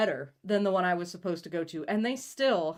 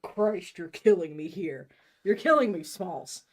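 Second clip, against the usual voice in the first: through clenched teeth; 7 dB louder than usual. Acted. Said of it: "I exclaim through clenched teeth".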